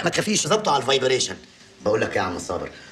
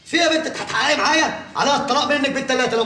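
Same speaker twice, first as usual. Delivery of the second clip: higher, louder repeat of the demand; louder scolding; 8 semitones higher, 3 dB louder than usual. Acted. Father repeats his demand to his daughter, louder and higher. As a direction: press her harder.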